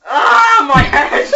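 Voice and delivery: loud hammed-up cry of pain; mock pained shout. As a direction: yell like your head hurts. Copyright of copyright symbol.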